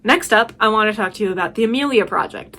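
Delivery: ordinary talk, nothing marked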